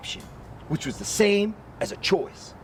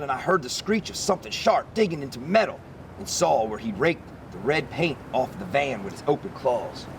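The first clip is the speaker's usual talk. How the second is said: ordinary delivery, nothing marked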